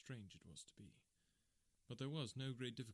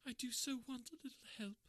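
much higher, tearful woman's voice; crying woman; 14 semitones higher than usual. Acted. Kate sobbing on her friend's shoulder, high tearful voice. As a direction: sob it out as Kate in distress.

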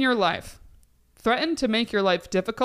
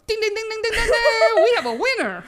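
game show chime; 13 semitones higher, 5 dB louder than usual. Pretend. Announcing a game show winner.

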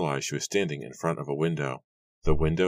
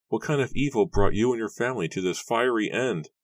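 anguished cry; 7 semitones higher than usual. A narrator's reading. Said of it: "Nora cried as she ran".

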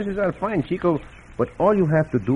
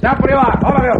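much higher, loud command; shout; 13 semitones higher, 7 dB louder, faster than usual. Acted. Cisco shouts at the bandits to halt, high and loud. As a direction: call out hard to stop the riders.

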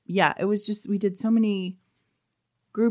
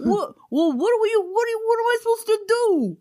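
very high mock American accent; hammed-up stage American, pitched way up; bad American accent. Flustered stage brother answering his sister, exaggerated American accent. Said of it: "the bad American accents".

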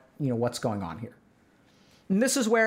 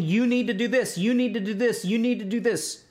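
higher, insistent mock order; bossy demand; 5 semitones higher than usual. Imitating a partner ordering the gamer around. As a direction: hammer it like a nagging command. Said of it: "Instead of saying".

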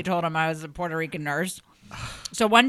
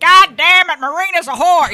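imitated yell; 11 semitones higher, 14 dB louder than usual. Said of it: "that's just what he says about anybody".

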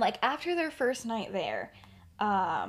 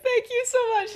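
much higher, choked-up thanks; tearful thanks; 6 dB louder than usual. Retold or imitated. Sister thanking Lily through tears, much higher. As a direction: thank her as if crying. Petunia sobbing, high.

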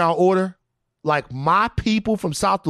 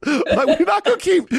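words breaking into laughter, much higher; laughing rant; 8 semitones higher than usual. Real, not acted. Laughing while ranting, pitch climbing.

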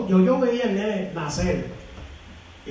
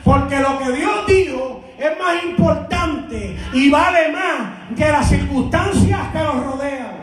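raised, drawn-out pulpit cries; full-force preaching; 5 semitones higher, slower than usual. Real, not acted. Preacher urging congregation not to let go of God's word, full-force preaching.

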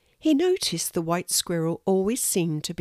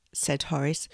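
ordinary delivery, nothing marked

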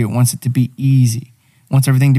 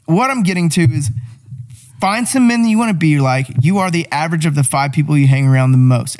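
normal talking voice

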